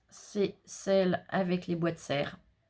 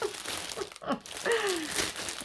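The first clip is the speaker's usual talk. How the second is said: high-pitched giggling fit; helpless high laughter; 13 semitones higher, 4 dB quieter than usual. Real, not acted. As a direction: burst into a long, high laugh. Laughs about the towel.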